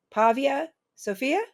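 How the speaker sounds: calling out a name, questioning; orderly calls out across the ER. Retold or imitated. Orderly calls for the patient across the room, calling out.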